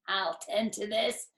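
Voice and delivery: chuckling. Acted. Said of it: "he chuckled".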